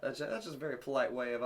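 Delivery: ordinary talk, nothing marked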